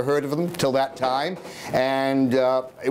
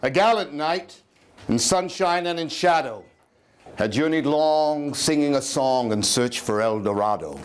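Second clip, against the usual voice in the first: poem recitation; 2 semitones lower than usual. Acted. Reciting Poe's El Dorado to listeners. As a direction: recite it like a bard by the fire.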